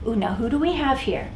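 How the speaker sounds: usual speaking voice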